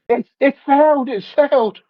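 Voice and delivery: high, whiny voice of a failing gadget; sad machine voice. Pretend. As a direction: wail it like a broken meter.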